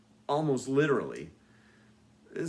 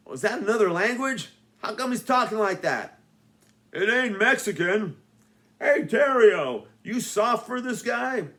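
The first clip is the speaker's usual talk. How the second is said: much higher, loud dopey jock; dumb goon voice; 9 semitones higher, 7 dB louder than usual. Acted. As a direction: play a thick-headed bully. Dopey goons puzzled and taunting.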